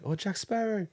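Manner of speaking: high, eager hawker calling out; mock the sellers' pushy welcome; hammed-up street vendor greeting; market seller voice. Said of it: "they'll be like".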